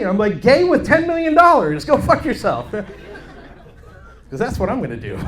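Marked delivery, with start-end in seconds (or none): 0.4-2.7 mock indignant yell. Mock indignant retort to friend.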